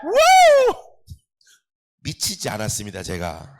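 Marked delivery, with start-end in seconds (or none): 0.0-0.8 very high, loud whoop; ecstatic whoop. Real, not acted. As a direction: let out a wild cheer. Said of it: "소리 질러 주님께". (아주 높고 크게 환호)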